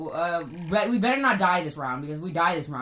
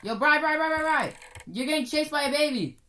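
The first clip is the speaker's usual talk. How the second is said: high urgent call; 7 semitones higher than usual. Real, not acted. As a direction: shout a warning across. Calling out a warning.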